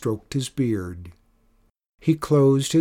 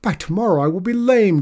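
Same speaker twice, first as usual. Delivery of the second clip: high, loud, hammed-up groan; 8 semitones higher, 6 dB louder, faster than usual. A narrator's reading. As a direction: groan like a self-pitying patient. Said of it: "groaned Nagel".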